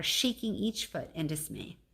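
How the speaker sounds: usual speaking voice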